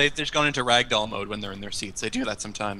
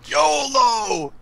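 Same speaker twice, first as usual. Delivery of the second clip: excited shout; 13 semitones higher, 6 dB louder than usual. Real, not acted. Cheering on risky speed-up.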